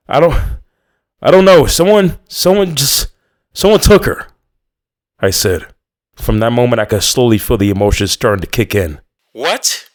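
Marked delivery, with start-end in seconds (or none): 0.1-4.3 high, loud, breaking with panic; panicked. A narrator's reading. Narrator tells the mother her daughter was taken, voice high with panic. 9.3-9.9 voice cracking. A narrator's reading. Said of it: "Her voice cracked instantly".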